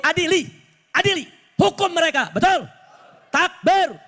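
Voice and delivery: high-pitched rally oratory, building to a shouted takbir; impassioned preaching. Real, not acted. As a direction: drive each demand home to the crowd. Preaches at full force.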